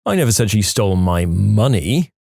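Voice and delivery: "money" held back and punched, rising; stress on MONEY. Shown as a demonstration. Testing a phrase's meanings, stress on "money". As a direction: save the emphasis for "money". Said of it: "depending on which word you stress".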